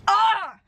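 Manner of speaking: high, drawn-out groan of frustration; frustrated cry. Real, not acted. Vlogger excusing the character's pattern but upset, frustrated cry. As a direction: let out an exasperated "ah".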